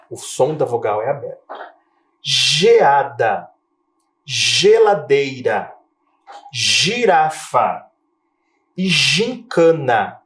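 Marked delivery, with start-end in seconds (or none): 2.2-10.2 "geladeira", "girafa" very slow, every G as J; teacher reading the J-sound words aloud. Shown as a demonstration. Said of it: "Perceba como o som é diferente".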